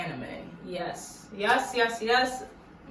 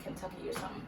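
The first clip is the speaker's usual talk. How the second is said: ordinary delivery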